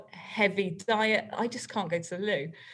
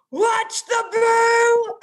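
very slow high loud call; drawn-out warning call; 13 semitones higher, 8 dB louder, much slower than usual. Retold or imitated. Group leader calls out a warning to the kids. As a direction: bellow the warning to the whole group.

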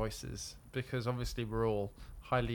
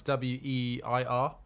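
spelled out slowly; 3 dB quieter, much slower than usual. Shown as a demonstration. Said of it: "Weir would be in the surname sense".

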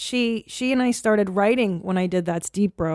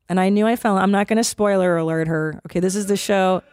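ordinary delivery

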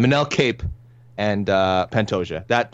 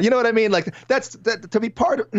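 strained, much higher pitch as the throat catches; choked up; 13 semitones higher than usual. Real, not acted. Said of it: "I'm getting choked up here".

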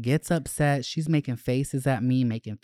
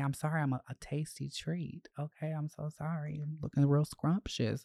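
soft sarcastic mock apology, a bit higher; mock-sorry to the jealous girlfriend, sweetly soft; 3 semitones higher, 8 dB quieter than usual. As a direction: purr it sweetly and insincerely.

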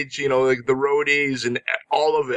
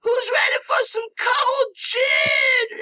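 high, slow rock-star call to the crowd; much slower than usual. Retold or imitated. Imitating [name] calling out to Toronto from the stage, high and slow. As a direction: stretch it out like a frontman hyping an arena. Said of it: "It's just like".

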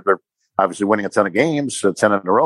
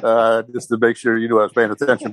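words spoken through a laugh; laughing while talking; 2 semitones higher than usual. Real, not acted. Guest admitting he saw the tweet, laughing. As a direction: answer while still laughing.

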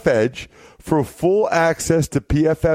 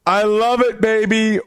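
pitched way up, gleeful; excited catchphrase; 8 semitones higher than usual. Real, not acted. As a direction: crow it out with delight. Host's gleeful opening catchphrase.

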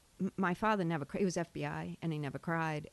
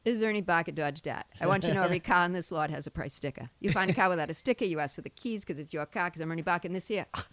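put-on thick Boston car-dealer accent; fast, loud car-lot pitch in broad Boston accent; Ernie Barker; 4 dB louder than usual. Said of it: "just do Eddie Barker Dodge Dart for me".

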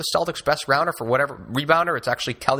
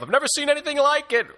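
high, quick mock broadcaster gasp; cliche announcer line; faster than usual. Podcaster mocking the old announcer cliche, high and quick. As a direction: toss it off like a hyped TV announcer. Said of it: "the old cliche of".

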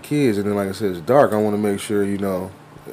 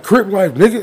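higher-pitched mock gang boast; cocky kid; 8 semitones higher, 5 dB louder than usual. Kid challenging the driver, imitated cockiness. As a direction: imitate a little kid acting tough, pitched up. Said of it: "looked at me and was like".

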